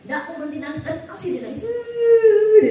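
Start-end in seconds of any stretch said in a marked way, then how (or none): 1.6-2.7 high, loud, slow mock sobbing; imitated crying. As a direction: wail it like the crying boy. Meniru tangisan anak. (tangisan anak yang ditirukan)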